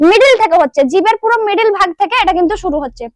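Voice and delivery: normal talking voice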